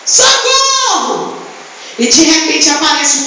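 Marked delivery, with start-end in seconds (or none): 0.0-1.4 drawn-out, high mock call for help; mock drowning man crying out, stretched and high; hammed-up cry for help. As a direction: wail socorro like a drowning man. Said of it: "E ele tava".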